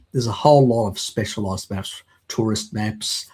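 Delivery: usual speaking voice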